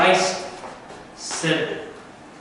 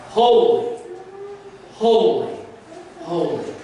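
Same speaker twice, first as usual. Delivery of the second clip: crying out; 7 semitones higher than usual. Acted. Seraphim round God's throne cry out holy. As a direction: ring it out like a seraph before the throne. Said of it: "still crying out".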